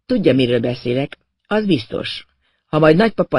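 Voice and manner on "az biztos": ordinary delivery, nothing marked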